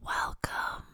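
breathy unvoiced whisper; mock ASMR welcome whispered into a sensitive mic. Shown as a demonstration. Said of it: "You wanna hear?".

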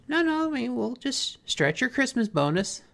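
high anxious reassuring; nervous Marge. Retold or imitated. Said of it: "And Marge is like".

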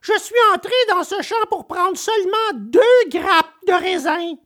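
high-pitched, pleading little voice; acted small boy protesting; tearful puppet. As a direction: plead your case in a high wail. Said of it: "s'écria Pinocchio en sanglotant".

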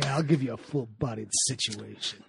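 low, soft seductive purr; a hint of bedroom voice; sultry murmur. Mock seductive replies.

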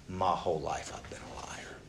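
hushed, broken whisper; a soft, ashamed whisper; whispered confession. Retold or imitated. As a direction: breathe it out like a guilty secret. Jacob confessing his sin.

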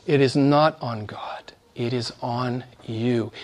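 preacher whispering to his listeners that it is on them; whispered. Real, not acted. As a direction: lean in and whisper the charge.

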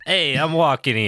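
put-on loud, high New York accent. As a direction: shout it like a New York cabbie. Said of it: "Were you gonna say I'm walking here?".

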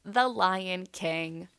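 slow, loud grand reveal; dramatic reveal. Real, not acted. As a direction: announce it like after a drum roll. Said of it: "that's my drum roll".

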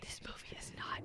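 unvoiced hushed aside; whisper. Real, not acted. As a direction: whisper it as a secret.

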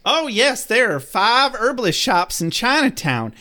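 lightly put-on Southern accent, high. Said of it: "she reminds us that she's Southern".

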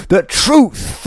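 high, loud, slow heel taunt; wrestling promo. Pretend. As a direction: sneer at the booing crowd. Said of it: "do it like a wrestling promo".

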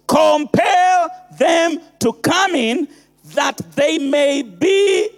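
compel the crowd with full force; thundering preacher. Acted. Preaching the master's command.

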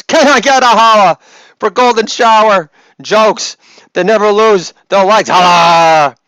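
loud, high-pitched holler; hammed-up crowd-hyping yell; hype shout. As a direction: belt out a mock call for applause. Repeats the mock hype call.

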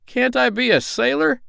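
high-pitched pirate whine of protest; pleading pirate. A narrator's reading.

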